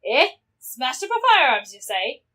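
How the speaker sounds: lightly voiced big fighter, wary then resolved; gruff game fighter. Fighter warning lassie of visitors, wary then commanding. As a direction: give orders like a seasoned fighter.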